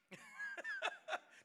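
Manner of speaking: real high burst of laughter. Real, not acted. Preacher laughing at his own story, high cackle.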